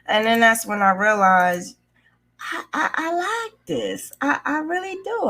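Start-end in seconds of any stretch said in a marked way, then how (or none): 2.4-5.3 heartfelt joy. Real, not acted. Telling viewers she loves graph making, lifted with joy. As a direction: let the joy lift your voice.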